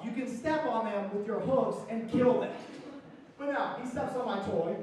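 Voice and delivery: usual speaking voice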